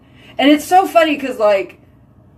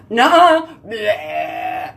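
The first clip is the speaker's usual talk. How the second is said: defiant mouth, imitated; much slower than usual. Her mouth defying her head, mock bratty. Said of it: "my mouth is like".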